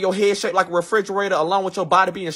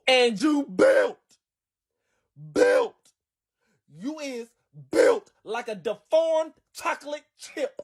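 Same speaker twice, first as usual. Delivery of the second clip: drawn-out roast build-up; 3 semitones higher, 4 dB quieter, slower than usual. Acted.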